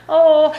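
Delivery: sharp, high, panicky in-breath; stressed gasp. Shown as a demonstration. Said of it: "you might have that shallow breathing with shoulders up".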